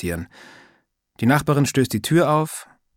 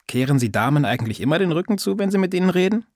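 higher, lightly voiced old neighbour, teasing; a prying old lady scolding lightly; old woman's voice; 7 semitones higher than usual. Old neighbour teasing Sascha about manners, lightly voiced old woman.

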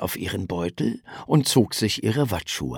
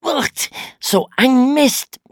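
genervt; 5 dB louder, slower than usual. A narrator's reading. Schuh im Schlick stecken.

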